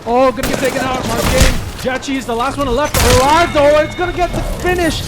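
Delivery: high, loud hype call; excited call. Real, not acted. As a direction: shout the finish as it happens. Excited call of final kill.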